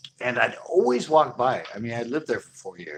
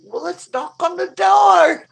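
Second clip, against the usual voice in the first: put-on high, slow, loud drunken shout; 12 dB louder, slower than usual. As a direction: bellow it like a drunk egging his mate on. Drunk friend urging.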